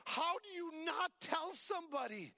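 ordinary talk

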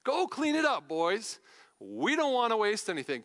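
delighted, emphatic mock command; relishing the line. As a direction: savour it as a triumphant punchline. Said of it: "I love that".

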